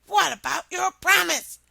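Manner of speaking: high loud shout; high indignant shout. Acted. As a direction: shout the reminder after her. Frog shouts after princess.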